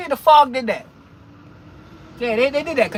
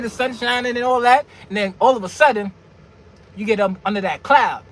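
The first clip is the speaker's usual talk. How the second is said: ordinary talk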